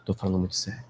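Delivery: low, soft and earnest; softly spoken seriousness; quiet and serious. Retold or imitated. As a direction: keep it hushed but firm. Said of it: "Bem baixinho".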